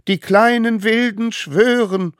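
high, loud call to repeat; high, loud oath. A narrator's reading. Smallest one calling out the oath. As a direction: proclaim it.

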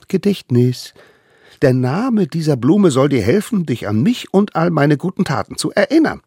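high, eager little voice; tiny eager hunter. Acted. Little hunter brings food to the mammoth, high and eager. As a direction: play the smallest one, proud and keen. Allerkleinste explains.

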